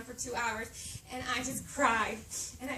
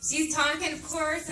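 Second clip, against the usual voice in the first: normal talking voice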